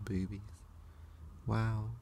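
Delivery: usual speaking voice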